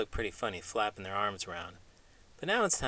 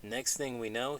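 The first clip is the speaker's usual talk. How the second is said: plain reading voice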